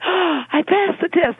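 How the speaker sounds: gasped, high-pitched mock triumph; gleeful acted-out exclamation, voice leaping high; delighted relief. Delighted when the baby smiles.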